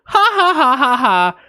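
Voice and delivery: high, put-on laugh; mock neighbor laugh. Storyteller mimics neighbors amused by their cat, high fake laugh. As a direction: imitate a delighted laugh, starting high.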